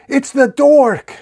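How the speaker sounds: very high, loud, drawn-out shriek; screaming. A narrator's reading. Wizard recognizing the dork, screaming. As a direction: shriek the discovery in alarm. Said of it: "Frizzard screams".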